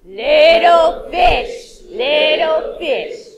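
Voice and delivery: very slow, loud menacing shark. Acted. Shark at the door demanding entry, menacing. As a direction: drag it out like a prowling shark. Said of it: "get your shark ready".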